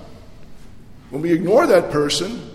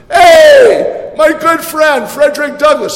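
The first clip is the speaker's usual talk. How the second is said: high, loud, joyful greeting across a crowded room; Lincoln's booming welcome; 10 semitones higher, 11 dB louder than usual. Retold or imitated. Lincoln greets Douglass so everybody hears, loud and beaming. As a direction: call out so the whole room hears. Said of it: "with a big smile and said".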